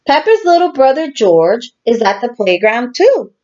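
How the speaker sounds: normal talking voice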